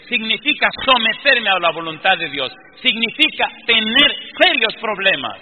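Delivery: loud, high fervent sermon; preaching at full force. Acted. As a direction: preach it to the whole congregation.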